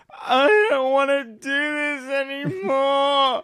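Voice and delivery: mock drunken wail, high and drawn out; almost crying. Housemate imitating drunk friend face down on the platform, almost crying. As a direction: wail it slowly like a hammed-up crybaby. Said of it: "almost crying, saying".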